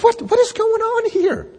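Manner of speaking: very high, incredulous squeal; mock bafflement. Reader confused by Jesus' argument, acted high bafflement. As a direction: act utterly lost, pitch shooting way up. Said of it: "And it's kind of like".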